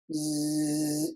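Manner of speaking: low, quiet sustained hum; droning buzz. Acted. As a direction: buzz like an ominous monolith. Imitating the monolith's hum.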